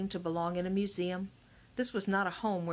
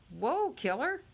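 higher-pitched startled exclamation; startled warding-off; 8 semitones higher, slower than usual. A narrator's reading. Boy backing away from a tiny dog, startled. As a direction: fend off a little dog, startled.

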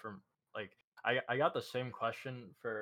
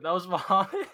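surprised laugh, much higher and louder; 8 semitones higher, 13 dB louder than usual. Real, not acted. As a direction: laugh it out in shock. Shocked at the question, laughing.

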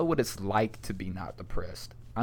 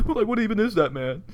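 normal talking voice

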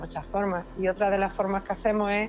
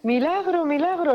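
high-pitched mock cry of a miracle; half-voiced exclamation, much higher; retold nun's cry; 9 semitones higher than usual. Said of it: "la monja gritando".

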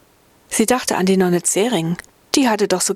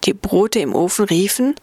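ordinary delivery

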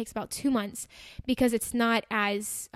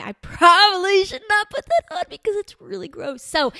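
disgusted inner thought; 8 semitones higher, 9 dB louder than usual. Retold or imitated. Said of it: "and then I thought".

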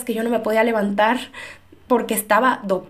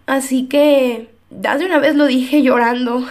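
ordinary talk, nothing marked

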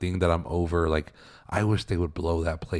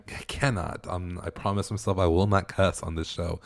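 ordinary talk, nothing marked

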